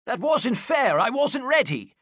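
high, loud indignant protest; loud complaint. A narrator's reading. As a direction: protest the unfair hit.

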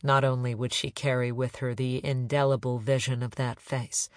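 usual speaking voice

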